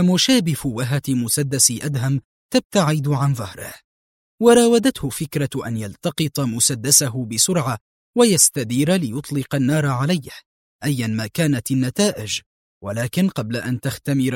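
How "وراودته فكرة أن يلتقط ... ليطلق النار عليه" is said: ordinary delivery, nothing marked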